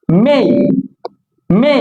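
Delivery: মে as MEY, gliding vowel; correct pronunciation. Shown as a demonstration. Teacher drilling for learners, correct pronunciation. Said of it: "এটা হচ্ছে কি".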